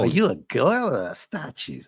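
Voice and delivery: put-on "girl" said as "goil" in a Brooklyn accent. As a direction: toss it off in a light Brooklyn accent. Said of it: "you gotta read it like that".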